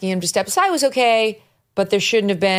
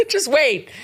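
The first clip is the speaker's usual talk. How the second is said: laughing; 7 semitones higher than usual. Real, not acted. Laughing while mimicking.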